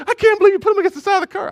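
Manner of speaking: high, loud, fast incredulous voice; mock shocked wife. Wife shocked at dad's roadside discipline, high disbelief. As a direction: squeal it in disbelief. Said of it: "and Elaine goes".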